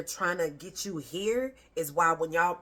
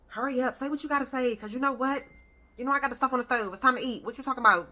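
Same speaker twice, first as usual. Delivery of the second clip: put-on higher and louder, impatient parent cutting the kid off; rushed parent; 7 semitones higher, 5 dB louder, faster than usual. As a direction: rush the child, food on the stove. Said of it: "Not from a rushed place".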